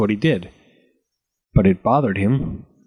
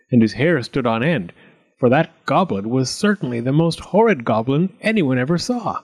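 usual speaking voice

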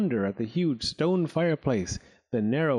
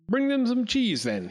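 commanding lord; 11 semitones higher than usual. Acted. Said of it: "he instructed".

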